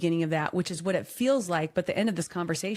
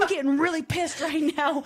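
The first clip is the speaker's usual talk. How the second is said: high-pitched, hammed-up angry outburst; mock tantrum; 9 semitones higher than usual. Mimicking unregulated kids in the car, mock tantrum. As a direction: imitate a kid lashing out at mom.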